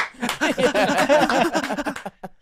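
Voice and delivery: hammed-up crowd laughter; mock crowd laugh. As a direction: fake a whole room laughing. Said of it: "the congregation's like".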